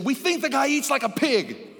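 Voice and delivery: high, loud, boisterous retelling; preacher's uproarious storytelling. Retold or imitated. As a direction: shout the family's mockery over the mayhem.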